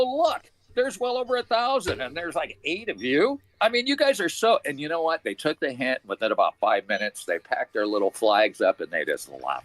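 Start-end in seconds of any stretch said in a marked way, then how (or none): 0.0-4.6 higher, mock-scornful taunt; retold taunt. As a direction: rub it in that they're outnumbered. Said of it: "I go".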